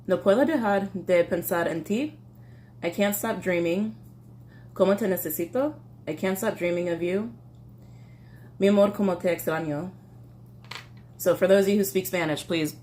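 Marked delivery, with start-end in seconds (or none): 0.1-9.9 careful lyric reading. Acted. A non-speaker trying Spanish lyrics for viewers, careful reading. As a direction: sound out each line, lifting the endings.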